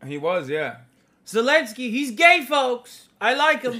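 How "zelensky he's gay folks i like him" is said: high, loud mock Trump; Trump impression. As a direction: ham up Trump saying folks. Mocking Trump's speech.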